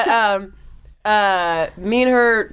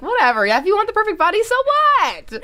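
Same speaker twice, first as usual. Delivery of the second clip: mock-defiant, climbing much higher to the end; acted dismissiveness; 12 semitones higher than usual. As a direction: shrug it off in a high, exaggerated voice. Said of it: "'cause they're like".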